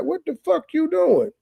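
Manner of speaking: high-pitched mock outrage from dad; imitating angry dad. Podcast host imitating a dad catching his son, in high mock outrage. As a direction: play the dad catching him at the grill, high and indignant. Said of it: "Dad was like".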